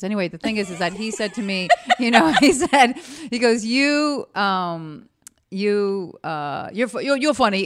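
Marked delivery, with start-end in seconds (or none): 1.8-3.7 laughing. Real, not acted. Laughing while starting the quote. 3.7-7.6 lightly voiced teacher with a lisp; a hint of the lisping acting teacher; impersonating acting teacher. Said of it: "He also had a lisp".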